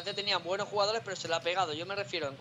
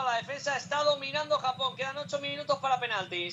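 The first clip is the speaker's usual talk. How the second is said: raised live match call; play-by-play commentary; 6 semitones higher than usual. Acted. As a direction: call the action live, pitch up.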